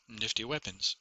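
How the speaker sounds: ordinary delivery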